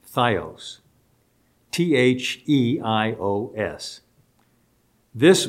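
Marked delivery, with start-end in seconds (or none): 0.1-0.8 Theos as THIGH-ohs; pronunciation of Theos. Shown as a demonstration. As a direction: say "Theos" with a long "eye" in the first syllable. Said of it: "is how it's pronounced".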